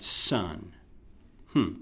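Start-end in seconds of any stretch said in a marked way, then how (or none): none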